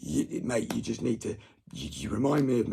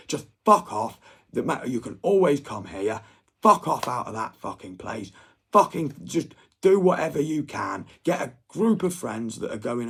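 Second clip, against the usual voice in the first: loud, high angry yell; urging his friend to leave home, shouting angrily; furious shouting; 3 semitones higher, 9 dB louder than usual. Real, not acted. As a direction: bark it at him, fed up.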